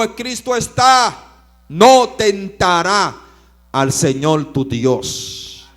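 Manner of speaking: very loud, high and slow; preacher voicing Jesus rebuking the devil; solemn proclamation. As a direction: thunder each word out.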